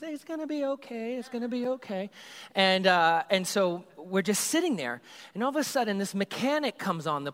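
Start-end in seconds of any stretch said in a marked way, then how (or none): 0.0-2.1 higher, softer soothing promise; calm the kids down gently; reassuring. Retold or imitated. Leader reassuring the youth group, soft and higher.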